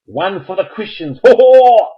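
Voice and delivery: high, loud cheer rising to a whoop; celebrating. Real, not acted. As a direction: crow over a point scored. Celebrating a point for Christians.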